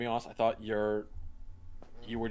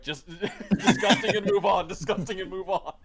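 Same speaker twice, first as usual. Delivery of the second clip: laughing imitation; 6 semitones higher, 3 dB louder than usual. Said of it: "do it like with Elric".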